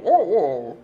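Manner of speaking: hammed-up London accent; mock London accent. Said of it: "en intento de acento londinense". (acento londinense de burla)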